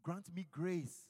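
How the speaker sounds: fervent call-and-response prayer; leading prayer. Acted. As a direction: pray aloud for the congregation, building. Leads congregation in prayer.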